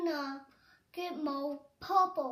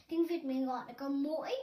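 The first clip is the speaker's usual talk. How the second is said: normal talking voice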